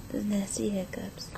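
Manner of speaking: soft breathy whisper. Real, not acted. Parent whispering to baby.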